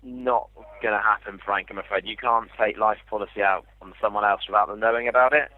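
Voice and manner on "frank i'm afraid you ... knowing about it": usual speaking voice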